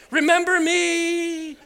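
high, drawn-out mock plea; melodramatic farewell. Husband texting wife last words, melodramatic. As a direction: stretch out dying last words. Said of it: "caps on".

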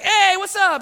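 high, loud cheery hello; hearty greeting. Retold or imitated. Regular patient greeting familiar clinic staff, excited. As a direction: greet old pals at high pitch.

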